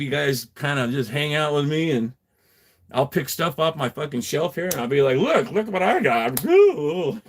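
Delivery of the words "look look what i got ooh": slow, higher mock-excited presenting voice; hammed-up wonder; playful show-off. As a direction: show off a treasure with a playful ooh. Acting out showing off his finds.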